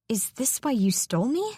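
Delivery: ordinary delivery, nothing marked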